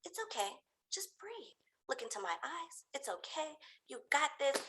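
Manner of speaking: very soft, sweet reassurance; soothing customer-service voice. Retold or imitated. Staff talking a climber through it, very soft soothing. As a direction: coax gently, almost whispering, like polite staff. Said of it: "just imagine the people in the Chick-fil-A line".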